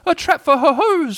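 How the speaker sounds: high, slow, muddled squeak; tiny high-pitched creature voice; flustered Piglet. A narrator's reading. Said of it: "says Piglet huskily".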